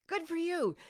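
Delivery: ordinary talk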